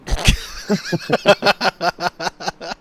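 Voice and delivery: loud burst of real laughter. Real, not acted. Cracking up at his friend's crude prediction, a loud real laugh. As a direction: crack up at the punchline. Reacting to the punchline.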